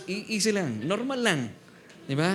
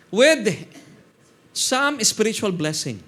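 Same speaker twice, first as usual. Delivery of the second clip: slow, louder emphatic reading; scripture reading; 4 semitones higher, 4 dB louder, much slower than usual. Acted. Pastor to church, reading the verse with emphasis. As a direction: stress some and draw out the phrase. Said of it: "reading scripture aloud".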